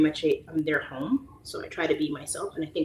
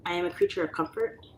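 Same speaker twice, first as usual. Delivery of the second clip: ordinary talk, nothing marked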